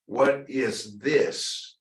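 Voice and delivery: slow, drawn-out What is this; patient rising question. Shown as a demonstration. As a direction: ask What is this slowly, rising at the end. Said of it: "The difference is".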